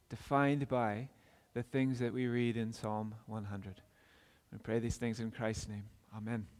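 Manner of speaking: low, soft, reverent pastoral prayer. Real, not acted. Pastor asking God to speak to our hearts, hushed prayer.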